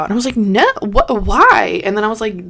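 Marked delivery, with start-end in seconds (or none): 0.4-1.8 retold protest, high and loud on why; indignant protest. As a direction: push back in disbelief at the officer. Retold protest at going to station.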